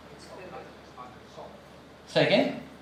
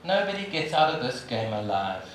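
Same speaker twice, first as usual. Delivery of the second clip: quiet repeat; 8 semitones lower, 7 dB quieter than usual. Shown as a demonstration.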